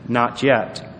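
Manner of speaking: low, soft, gentle answer; gentle refusal. Acted. Master gently answering the yelling clay, gentle. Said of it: "he only smiled and gently said".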